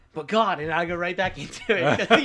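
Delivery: loud, high laughing "But God"; real laughter bursting through; laughing protest. Real, not acted. Laughing at her own protest.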